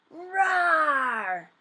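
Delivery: loud hammed-up roar; bear roar. A narrator's reading. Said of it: "let out a great big roar".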